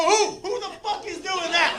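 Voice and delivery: high, indignant questioning; incredulous challenge. Retold or imitated. Comic challenging dude's everybody claim, incredulous protest. Acted argument with dancer.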